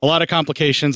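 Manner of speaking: ordinary talk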